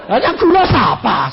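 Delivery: loud laughing comeback; laughing retort. Retold or imitated. Pastor answers the scared caller: loud laughing retort. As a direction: toss back the question with a laugh.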